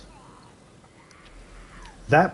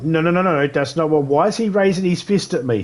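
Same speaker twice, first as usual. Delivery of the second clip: high, loud flustered protest; panicked denial; 6 semitones higher, 4 dB louder than usual. Retold or imitated. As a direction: backpedal fast and high as the fist comes up. Panicked denial after mistranslation.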